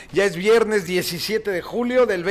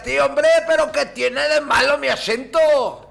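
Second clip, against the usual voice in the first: mock Spaniard from Spain, higher and louder; Spain Spanish accent; 6 semitones higher, 4 dB louder than usual. Radio host mimics an offended Spaniard in a mock Castilian accent. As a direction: put on a Castilian accent to protest. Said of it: "algún españolete ... y diga".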